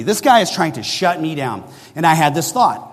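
ordinary talk, nothing marked

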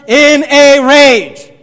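"In a rage" loud and very slow; stress on IN A RAGE. Shown as a demonstration. Preacher highlighting how Naaman went away, emphatic "In a rage". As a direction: punch each word of "In a rage", stretched out. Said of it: "Look at these words".

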